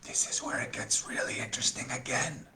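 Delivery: hushed aside to the class; whisper. Real, not acted. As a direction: lean in and whisper it.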